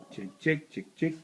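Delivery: sing-song chicken call. Real, not acted. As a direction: call the hens over. Calling chickens at the farm.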